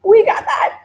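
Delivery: high, loud triumphant call; excited shout. Retold or imitated. Checklist quote, climax.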